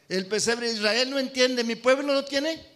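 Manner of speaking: loud, high, slowed proclamation; emphatic preaching. Acted. Preacher building toward a point, loud and slow. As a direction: build it up for the congregation.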